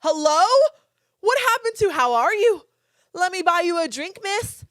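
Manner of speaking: high, loud, exasperated protest; indignant outburst. Acted. Woman objecting to a stranger's crude compliment, loud high indignation. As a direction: belt it out in mock outrage.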